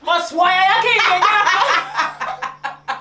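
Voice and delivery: very high, loud uncle impression; booming uncle. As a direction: blast it out like a shouting uncle. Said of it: "This is how my uncle speaks".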